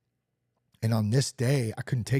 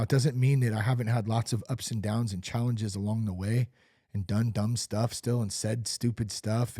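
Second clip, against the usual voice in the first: ordinary talk, nothing marked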